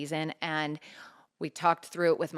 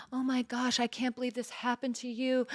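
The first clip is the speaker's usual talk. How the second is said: mock crying caller; 7 semitones higher than usual. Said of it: "they're like".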